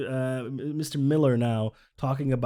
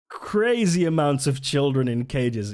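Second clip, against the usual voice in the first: usual speaking voice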